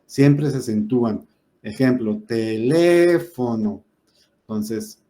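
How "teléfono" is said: teléfono drawn out slowly, leaning hard on LÉ; stress on LE. Shown as a demonstration. Teacher showing the class where the stress falls, teléfono with a long stressed LÉ. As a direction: stretch teléfono so the stressed LÉ stands out. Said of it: "aquí cayó la fuerza de la voz".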